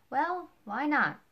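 a sharp, baffled why not; puzzled question. Retold or imitated. Ford pushes back on Spencer.

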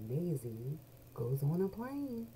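plain reading voice

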